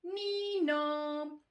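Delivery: two-note siren imitation; sustained nee-naw siren sound; police siren. A narrator's reading.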